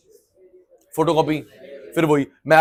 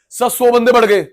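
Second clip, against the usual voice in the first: excited caller; 6 semitones higher, 5 dB louder than usual. Retold or imitated. Caller reports guests to caterer.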